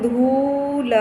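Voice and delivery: धूल with a very long drawn-out oo; taking time. Shown as a demonstration. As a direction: linger on the vowel of धूल. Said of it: "आप time देके बोल सकते हैं".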